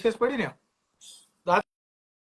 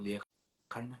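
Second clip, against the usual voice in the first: soft coaxing; 7 semitones lower, 14 dB quieter, much slower than usual. Retold or imitated. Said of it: "પ્રેમથી જ કેવું પડે".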